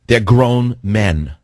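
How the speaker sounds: drawn out very slowly, each word weighted; slow it right down and lean on each word; slow, emphatic. Real, not acted. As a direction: spell it out like he can't believe it. Host hammers his point.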